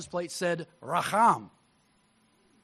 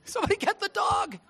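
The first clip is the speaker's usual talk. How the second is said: yelling at the computer; 15 dB louder than usual. Retold or imitated. Viewer yelling at a video of a dog in ice, a loud pleading shout. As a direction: belt it out as if begging the screen to save the dog. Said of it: "yelling at the computer".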